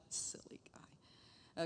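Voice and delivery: a breathy, scornful whisper; tiny mocking whisper; whispered aside. Real, not acted. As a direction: whisper it like a private joke. Mocking aside about Satan.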